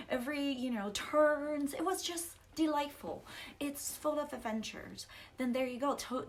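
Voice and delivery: ordinary delivery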